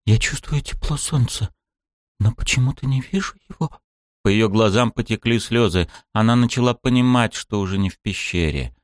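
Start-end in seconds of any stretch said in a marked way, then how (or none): 0.1-3.7 put-on higher, lost young woman; puzzled and scared; princess voice. A narrator's reading. Blind princess, confused.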